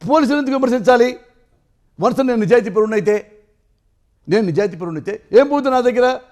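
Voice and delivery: impassioned protest. Real, not acted. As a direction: protest his innocence with rising heat. Heated rhetorical defence of his honesty. (ఆవేశంగా నిలదీస్తూ)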